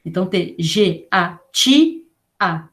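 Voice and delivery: G-A-T-A read slowly letter by letter; teacher dictating G-A-T-A; spelling out letters. Shown as a demonstration. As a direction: spell G, A, T, A one at a time. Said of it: "de gelatina".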